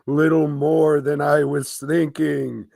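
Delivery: high, slow spooky ghost. Acted. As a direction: moan it out like a friendly ghost. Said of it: "I'm doing the ghost voice".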